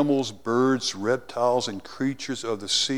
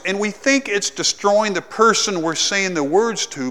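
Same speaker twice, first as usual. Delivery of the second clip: loud, raised preaching voice; fiery preaching; 7 semitones higher, 8 dB louder than usual. Acted. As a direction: preach it hard to the congregation. Preaching at full force.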